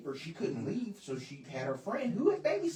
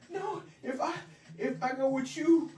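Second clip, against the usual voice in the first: higher, mock-tearful child's protest; retold tearful pleading; 8 semitones higher than usual. Boy refusing to go with grandmother, mock-tearful pleading. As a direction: plead like a crying kid, half-laughing. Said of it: "I started crying".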